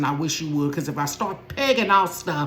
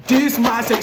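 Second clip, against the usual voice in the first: son's loud announcement; 6 semitones higher, 8 dB louder than usual. Retold or imitated. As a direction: announce it to the whole house. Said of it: "So here he go".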